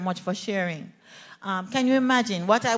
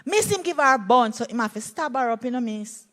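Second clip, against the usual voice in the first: a hint of a Jamaican boy explaining, matter-of-fact; lightly voiced child in Jamaican Patois, a little louder at the start; 3 semitones higher, 3 dB louder than usual. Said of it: "I said, "What is happening?".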